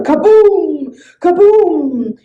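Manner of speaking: loud kaboom; booming thunder. A narrator's reading. Thunder crashing over fire, booming. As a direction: roar it like thunder.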